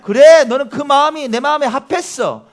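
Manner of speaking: booming approval from God; God's approving voice. Retold or imitated. Preacher voices God answering.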